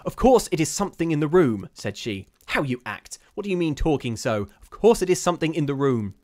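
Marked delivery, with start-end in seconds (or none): 0.0-1.7 high, loud, angry insistence; furious retort. A narrator's reading. Caroline rebuking Rebecca, with a sort of fury. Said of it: "turned upon her with a sort of fury". 2.5-6.1 raised, loud scolding; angry scolding. A narrator's reading. Caroline rebuking Rebecca for her talk, angry. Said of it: "turned upon her with a sort of fury".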